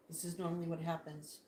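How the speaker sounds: soft aside while watching birds; hushed. Real, not acted. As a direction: murmur it so as not to disturb them. Quietly watching birds at window.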